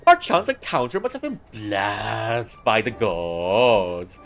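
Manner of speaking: high, slow, hammed-up aristocrat voice; overacted posh period drama. Friends greeting at a chance encounter, hammed-up posh voice. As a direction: ham it up like a stuffy noble. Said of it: "I'm just overacting this on purpose".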